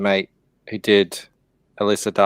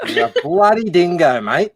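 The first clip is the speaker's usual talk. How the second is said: a hammed-up Aussie insult, stretched "bloody"; mock Australian accent. Friend repeats the Aussie line on request in a mock Australian accent. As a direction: lay it on thick like a cartoon Australian. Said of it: "say that again".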